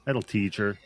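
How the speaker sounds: ordinary delivery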